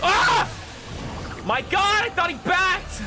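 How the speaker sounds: sudden high, loud shout of shock; real startled yell. Real, not acted. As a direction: yell out in genuine surprise. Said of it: "I yelled so hard".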